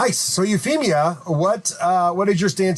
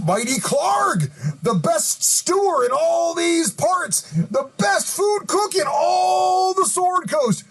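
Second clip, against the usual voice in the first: boastful shout; 8 semitones higher, slower than usual. Acted. Hobgoblin boasting to the travelers about Clarg, shouting high. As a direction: crow about your boss like a fan.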